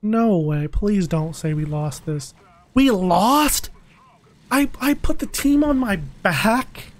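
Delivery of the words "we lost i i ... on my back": high, incredulous protest; stunned disbelief. Real, not acted. Gamer learning the team lost, stunned and indignant. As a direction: cry out in high-pitched disbelief at the loss.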